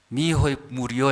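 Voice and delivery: ordinary talk, nothing marked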